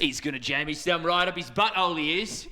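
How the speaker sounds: lightly put-on Aussie accent; a shaky imitation of an Australian bloke. Co-caster ribbing the player in a mock Aussie accent. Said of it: "two, uh, shitty Aussie accents".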